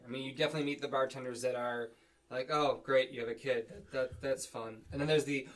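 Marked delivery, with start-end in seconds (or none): 2.5-4.8 understated, falling polite welcome; lukewarm politeness. Acted. Bartender greeting a parent with a kid, lukewarm politeness. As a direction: politely accept it without enthusiasm. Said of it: "there's definitely a different reaction".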